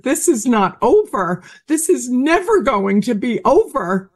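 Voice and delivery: high-pitched mock yell, no real volume; retold mother's yell. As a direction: imitate an upset mother crying out. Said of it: "my mother just yelled".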